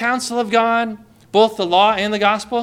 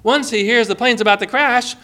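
usual speaking voice

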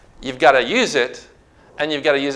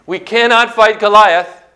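raised, louder preaching voice; forceful declaration; 6 semitones higher, 5 dB louder than usual. Shown as a demonstration. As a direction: declare it boldly, higher and louder. Demonstrates not being a church mouse.

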